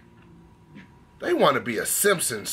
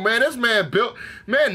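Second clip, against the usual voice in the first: ordinary delivery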